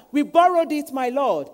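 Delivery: loud, high plea to a master; crying out. Retold or imitated. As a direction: call out in alarm to the prophet. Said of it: "they cried to Elisha".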